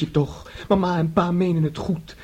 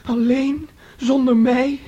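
alarmed disbelief; 6 semitones higher, 5 dB louder, slower than usual. Acted. Son alarmed she leaves.

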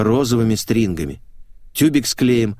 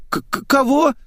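put-on high, faltering girlish stutter; stammering. A narrator's reading. Girl caught out by her friend, high stammer. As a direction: trip over the first sound, pitched high. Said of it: "запнулся голосок".

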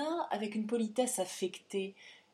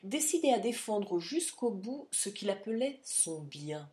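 ordinary delivery, nothing marked

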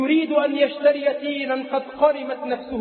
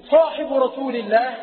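usual speaking voice

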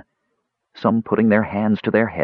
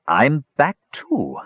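slow, higher-pitched realization; a stretched-out, higher voice of discovery; amazed relief; 5 semitones higher, slower than usual. A narrator's reading. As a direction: draw it out in dawning wonder. Restored wizard's voice speaks up.

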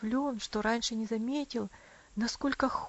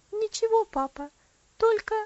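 breaking into sobs; 11 semitones higher, 7 dB louder than usual. A narrator's reading. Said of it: "потонул в рыданиях".

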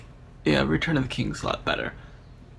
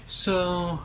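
long drawn-out, higher "so"; sounding like Asa; 5 semitones higher than usual. Real, not acted. Host stalling before wrapping up, drawn out like Asa. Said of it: "You sound like Asa".

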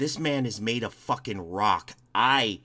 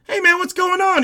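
high, loud re-enacted hello; shouted greeting; 10 dB louder than usual. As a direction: call out to a pal across a crowd. Acts out greeting across the hall.

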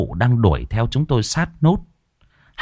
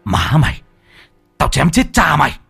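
furious yell; 5 semitones higher than usual. Acted. Năm charging the ghost with a dagger, furious yell. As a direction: roar it as you charge with the knife.

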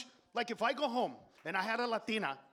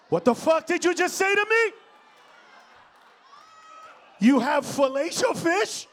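shouted, much higher disbelief; shocked shout; 9 semitones higher, 12 dB louder than usual. Retold or imitated. Customer outraged at the cashier's answer, shouting. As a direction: yell it back in outrage.

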